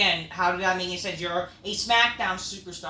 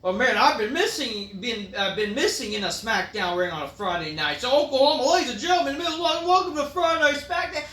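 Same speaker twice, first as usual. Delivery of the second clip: hammed-up cornball Orton, louder as he welcomes the crowd; cheesy babyface promo; 4 semitones higher, 5 dB louder than usual. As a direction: ham up the corny welcome.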